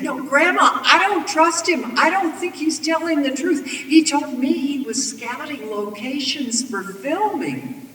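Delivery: put-on high, loud, urgent child's warning; alarmed girl. A narrator's reading. Granddaughter warning Grandma not to trust him, urgent. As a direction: plead urgently, pitched well up.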